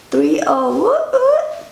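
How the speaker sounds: high, whooping birthday cheer; playful cheer. Real, not acted. Wife announcing her thirtieth birthday, playful cheer. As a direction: whoop it up, pitch leaping high.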